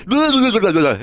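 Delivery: high, loud nonsense babble; mock babbling monster girl. Streamer imitating the drunk girl's babble, high and loud. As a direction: shriek gibberish like the drunk girl. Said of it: "She goes".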